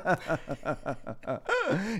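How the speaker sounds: high, pulsing mock giggle; imitated nervous laugh. Hostess who cannot stop laughing, mock nervous laugh. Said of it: "the nervous laugh".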